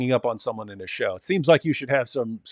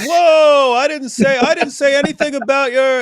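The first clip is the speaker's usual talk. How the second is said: imitating him in a much higher, louder squeaky protest; mocking whine; 12 semitones higher, 8 dB louder than usual. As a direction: squeak it out like a startled, backpedaling debater. Mocking opponent's backpedal in story.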